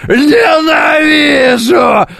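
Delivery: long, high, crying scream; anguished shout. Retold or imitated. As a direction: howl it out in despair. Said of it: "Помните, как, э, в «Звёздных войнах»?".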